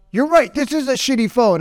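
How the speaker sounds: high-pitched retold yell; yelling back. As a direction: yell back in mock anger, agreeing hard. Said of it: "he started yelling back".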